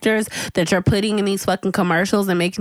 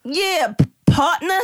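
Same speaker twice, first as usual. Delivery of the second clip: higher, hammed-up country twang, cracking into a laugh; mock Southern twang; 6 semitones higher, slower than usual. Mocking an actor forced into a fake Southern twang for an ad. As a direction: overdo a fake Southern drawl. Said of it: "You know the twang we're talking about".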